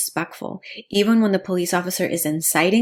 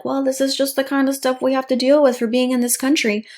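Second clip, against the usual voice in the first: high-pitched put-on voice of accepting parents; resigned parents imitation; 7 semitones higher than usual. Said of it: "They're like".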